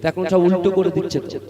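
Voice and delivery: usual speaking voice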